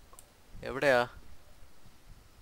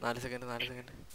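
whispering while hiding; 2 semitones lower, 16 dB quieter than usual. Real, not acted. Hiding, counting down quietly.

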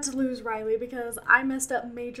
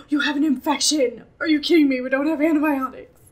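shocked; 13 dB louder than usual. Real, not acted. Shocked by infection.

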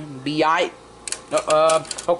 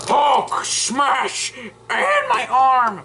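higher, slow toy Hulk roar; Hulk battle cry; 7 semitones higher, 3 dB louder, much slower than usual. Pretend. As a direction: play Hulk smashing, drawn out and high.

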